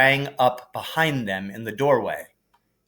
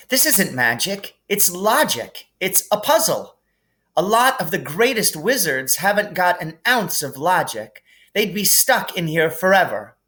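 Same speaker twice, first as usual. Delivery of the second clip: lightly voiced clever girl, a bit higher and brisk; thrilled she's cracked a puzzle; eager Hermione; 7 semitones higher than usual.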